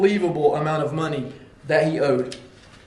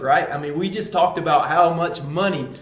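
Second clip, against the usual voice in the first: ordinary delivery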